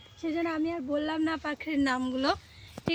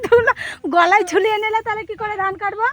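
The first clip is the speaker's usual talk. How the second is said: raised, higher calling voice; loud call; 6 semitones higher, 10 dB louder than usual. Real, not acted. Girl shouting back to mother about the bag, loud. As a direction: shout the question across the field. Arguing loudly with mother far off.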